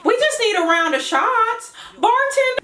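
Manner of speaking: high, bubbly excited friend; Joy. Acted. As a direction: gush like a party-hungry friend. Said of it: "let's do the voices".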